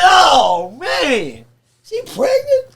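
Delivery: loud wail rising to a high squeak; dismayed shock. Retold or imitated. Defendant exposed before his girlfriend, loud high dismay. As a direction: cry it out in hammed-up disbelief. Retold dismay at pregnancy news.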